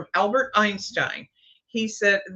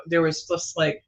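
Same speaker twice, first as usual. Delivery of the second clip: ordinary delivery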